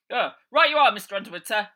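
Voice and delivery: high, loud servile lackey; high, loud servant voice; cowed henchman. Acted. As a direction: hurry to obey the boss. Henchman obeys.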